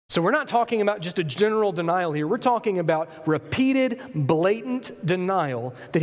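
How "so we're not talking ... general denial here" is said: normal talking voice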